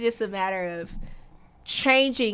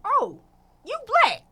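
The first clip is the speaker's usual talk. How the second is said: high, amused surprise; a bright squeal of discovery; surprised; 13 semitones higher, 3 dB louder than usual. Retold or imitated. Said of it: "and he said".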